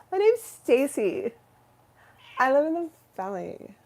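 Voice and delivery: a hammed-up Valley Girl introducing herself. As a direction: play a ditzy Valley Girl on a dating ad. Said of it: "I kinda like the valley accent".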